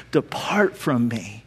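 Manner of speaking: low, soft and slow, a grave dismissal; quiet, solemn rejection; stern judgment. Acted. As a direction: pronounce it like a final verdict. King rejecting the goats.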